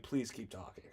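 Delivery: low, very soft retold plea; unspoken aside. Smitten customer to British girl, soft unspoken plea. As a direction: murmur it as a thought kept to yourself. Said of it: "I almost said it out loud".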